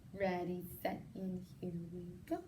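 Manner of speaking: hushed, breathless whisper of suspense. A narrator's reading. Narrator to young listeners, a suspenseful whisper. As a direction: breathe it out like a secret. Read-aloud countdown, hushed take.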